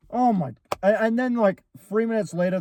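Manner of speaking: ordinary delivery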